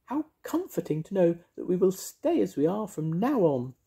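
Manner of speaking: high, sing-song witchy voice; bright, bossy little witch. Acted. Mavis voiced.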